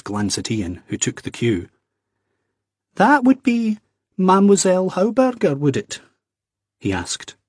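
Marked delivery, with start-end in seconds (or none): 3.0-6.0 pointed question. A narrator's reading.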